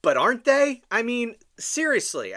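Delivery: loud incredulous rant; exasperated outburst. Real, not acted. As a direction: burst out in disbelief. Exasperated outburst about maga relatives.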